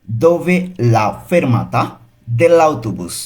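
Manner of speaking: slow, careful Italian for learners; very slow model reading. Shown as a demonstration. Teacher models the phrase slowly for students. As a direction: spell it out slowly for the class. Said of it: "এর Italian কী হবে?".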